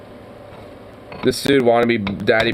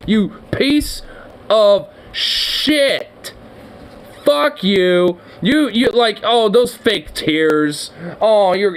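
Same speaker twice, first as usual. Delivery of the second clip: outraged rant; 7 semitones higher than usual. Real, not acted. Furious rant at the fake crying.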